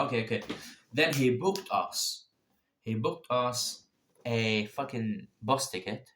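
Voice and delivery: ordinary delivery, nothing marked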